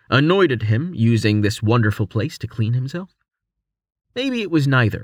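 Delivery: plain reading voice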